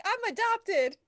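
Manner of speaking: lightly imitating Barkley's awkward blurt; Barkley impression. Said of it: "He's like".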